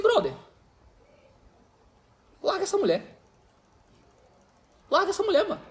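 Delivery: pushing the friend to leave her, half-joking; mock blunt advice. Pastor retelling blunt advice. (conselho provocador em tom mais agudo)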